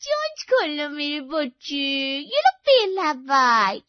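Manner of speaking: high, loud, slow sing-song coaxing; stretched-out, high baby talk; baby-feeding girlfriend. Acted. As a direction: coax a big baby to open up. Voices Payal baby-feeding her boyfriend.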